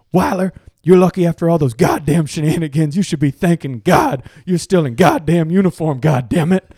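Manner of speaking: loud, high hammed-up coach tirade; imitated coach's scolding. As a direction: chew out the player as a mock coach. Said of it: "And he goes".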